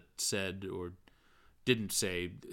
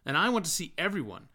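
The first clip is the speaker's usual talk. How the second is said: higher, quick, earnest speechifying; dramatic force; 6 semitones higher, 4 dB louder, faster than usual. A narrator's reading. Bloom lectures Stephen on Ireland, high and fast. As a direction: Bloom talking himself into a passion. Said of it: "he resumed with dramatic force".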